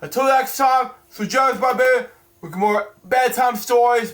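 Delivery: normal talking voice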